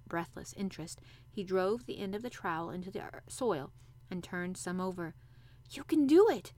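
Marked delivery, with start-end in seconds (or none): 5.7-6.5 high, loud, eager chant; will him on with all your might; excited girl's emphatic urging; urging to herself. A narrator's reading. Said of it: "said Mary to herself".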